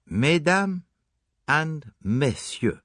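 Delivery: a lightly French Poirot, formal and grand; stately French detective opening; French-accented address. Acted. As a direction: address the room like a lecturer about to begin. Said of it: "bowing as though he were a celebrity about to deliver a lecture".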